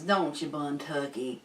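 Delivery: ordinary delivery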